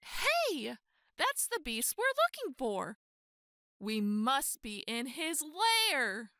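ordinary delivery

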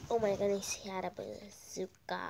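ordinary talk, nothing marked

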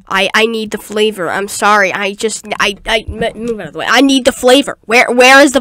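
loud, low hammed-up craving; mock desperate yell. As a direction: rant for the flavor like an addict.

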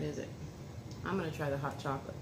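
ordinary talk

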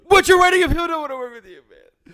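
rushed dramatic finish. Pretend. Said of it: "the most theatrical voice you can".

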